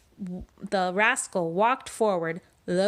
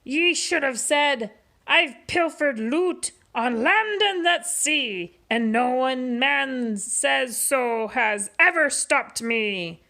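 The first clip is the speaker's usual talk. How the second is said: louder, higher pirate boast; mock shout; 5 semitones higher, 3 dB louder than usual. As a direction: show him how a pirate brags. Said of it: "Pete shouted".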